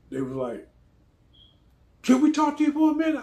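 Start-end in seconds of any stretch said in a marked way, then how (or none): 2.0-3.2 high, loud, quick mimicked voice.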